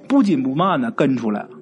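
ordinary delivery

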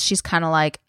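ordinary delivery

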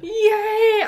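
high, loud happy cheer; excited cheer. Real, not acted. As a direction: cheer it out, thrilled. Excited about Star Trek.

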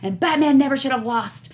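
like an outraged fan at a con; excited fan rant. Retold or imitated. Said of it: "people run up to me like".

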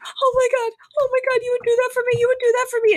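very high, fast, excited squeal; squealing Fran gush. Retold or imitated. Recapping the show, Fran thrilled at Niles's offer, high fast squeal.

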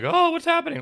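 high, loud mock bewilderment; wide-eyed helplessness. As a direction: play a clueless, one-note heroine. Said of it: "wide-eyed".

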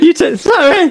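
high squeaky imitation; mock squeaky sorry. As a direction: mimic the cracked sorry way up high. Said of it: "You said it to me".

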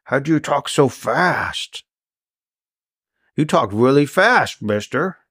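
slow, laboured character voice; Grendon, slow talker. Acted. Grendon marvels at the fast talker, slowly. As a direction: draw the words out as if talking hurts. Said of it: "It hurts when I talk, so I talk real slow".

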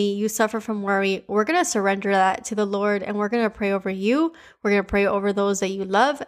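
ordinary delivery

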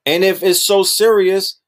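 ordinary talk

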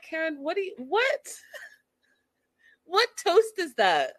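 squeaky incredulous "what toast is that?"; baffled laughing disbelief. Retold or imitated. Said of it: "I was just like".

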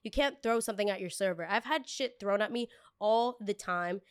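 usual speaking voice

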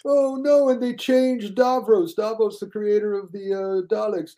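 high, slow wail of outrage; high loud mock lament, stretched out; mock whiny fan. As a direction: whine like a fan whose show died. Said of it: "And it's like".

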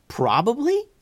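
high, incredulous question; alarmed. Acted. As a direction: squeak it in disbelief.

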